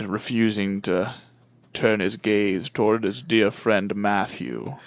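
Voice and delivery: low, slow storyteller voice; dramatic narration. A narrator's reading. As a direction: draw it out gravely, like a suspense tale. Mock-solemn story narration.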